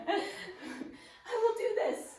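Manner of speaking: high, laughing mock determination; laughing pep voice. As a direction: giggle through a fake vow.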